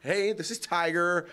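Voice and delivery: mocking impression, high, loud and drawn out; Tiger Woods. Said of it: "it's my impression of ... how Tiger Woods".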